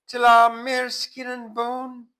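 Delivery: high, loud mock pining; pining widow. Kitty complaining to the narrator, high mock pining. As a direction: lament it in a high wail. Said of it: "she says".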